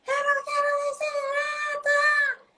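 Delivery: high-pitched mock wail of a small boy; screaming child. Son wailing at his mother in the kitchen, screaming tantrum. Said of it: "começou a gritar".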